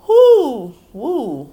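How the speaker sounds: sudden high whoop, as if struck by the verse; a real, startled whoo; stung whoop. Real, not acted. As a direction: yelp as the words hit home. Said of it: "that hurt".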